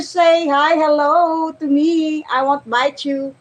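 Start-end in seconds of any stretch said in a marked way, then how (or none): none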